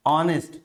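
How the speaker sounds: ऑनेस्ट, open o, no h; honest without h. Shown as a demonstration. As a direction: model ऑनेस्ट cleanly. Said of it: "ये आता है इसका उच्चारण".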